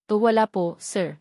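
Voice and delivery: ordinary delivery, nothing marked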